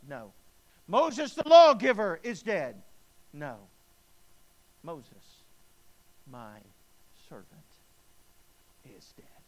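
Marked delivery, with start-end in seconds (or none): 0.9-2.8 very loud, slow, ringing cry; thundering slow proclamation. Acted. Preacher thundering God's words to the congregation, slow and loud. 4.8-9.4 soft, fading to a whisper; hushed, tender whisper. Acted. God tenderly telling Joshua of his servant, whispered. Climax drops to hush.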